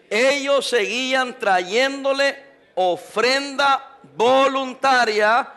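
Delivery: slow reading that leans hard and rising on "voluntaria"; stress on voluntaria. Shown as a demonstration. Pastor reading scripture to the church, leaning on "voluntaria". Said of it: "Enfatizo la palabra voluntaria".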